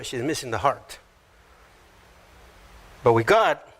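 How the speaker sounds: usual speaking voice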